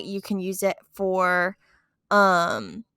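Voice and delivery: talking through a sore jaw; stiff, sore jaw slowing the words. Real, not acted. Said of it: "talking is hard today".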